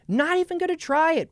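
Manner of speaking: high, insistent mock protest; mock refusal. Actor refusing to try an accent, mock protest. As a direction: pitch the refusal way up. Acted refusal, repeated higher.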